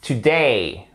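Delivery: "Today" qui finit en é-i descendant; étire la diphtongue de "Today"; diphtongue juste. Shown as a demonstration. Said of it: "C'est pas un". ("Today" à l'anglaise, DAY glissé)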